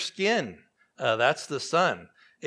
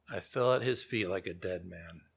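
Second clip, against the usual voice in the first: quiet, heavy repeat; 7 semitones lower, 8 dB quieter than usual. Shown as a demonstration. Preacher repeating the verse so the congregation feels it, lower and softer. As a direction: lower and softer, letting it sink in. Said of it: "look at the details".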